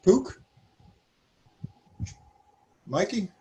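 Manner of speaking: normal talking voice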